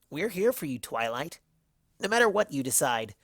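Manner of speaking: grinning, confident reassurance; lightly voiced cocky pony insisting; a brash friend promising loyalty. A narrator's reading. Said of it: "insisted with a cocky grin".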